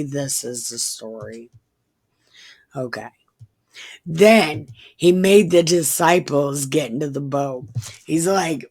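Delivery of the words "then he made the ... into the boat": usual speaking voice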